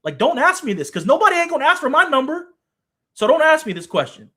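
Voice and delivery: Joe's imagined reply, rising in annoyed force; imagined blunt retort. Retold or imitated. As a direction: voice Joe brushing off the question, fired up. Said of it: "he's like".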